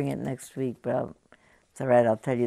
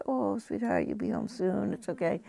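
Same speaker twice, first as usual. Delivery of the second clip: put-on higher, soft cooing to a baby in line; soothing a crying baby; 7 semitones higher, 4 dB quieter than usual. Shopper at checkout soothing a crying baby, soft and high. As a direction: coo gently to calm a stranger's upset infant. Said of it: "you start to talk to it".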